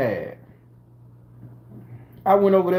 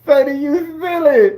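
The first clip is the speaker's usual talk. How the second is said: higher, half laughing half crying; laughing with tears; 9 semitones higher, 5 dB louder than usual. Real, not acted. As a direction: laugh until it turns teary. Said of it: "the laugh got some tears in it".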